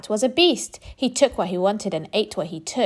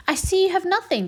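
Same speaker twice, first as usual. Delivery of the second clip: much higher whiny complaint; complaining rat; 10 semitones higher than usual. A narrator's reading. Rat complaining to the duck, whiny and high. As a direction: whine it as the rat. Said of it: "the rat complained".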